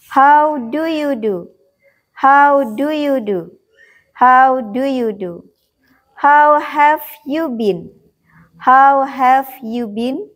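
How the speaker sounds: slow, deliberate teacher's drill; model pronunciation. Shown as a demonstration. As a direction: say each phrase slowly for learners to copy. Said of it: "Guru mengucapkan sebanyak tiga kali".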